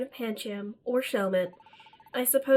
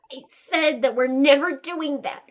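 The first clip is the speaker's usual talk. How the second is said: defiant; 7 dB louder than usual. Acted. Hero repeats refusal.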